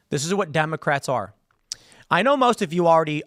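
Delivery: ordinary delivery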